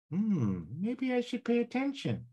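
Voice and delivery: a tiny voice grudgingly giving in; subconscious voice. Shown as a demonstration. Coach's subconscious voice finally starting to listen. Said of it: "use my little funny voices".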